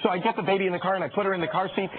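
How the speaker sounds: ordinary talk, nothing marked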